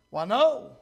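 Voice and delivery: ordinary talk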